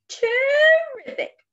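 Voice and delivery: high, loud, long stretched exclamation; slow turtle. Acted. Turtle accepts the Thanksgiving invitation, slow turtle voice. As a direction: draw the word out with delight.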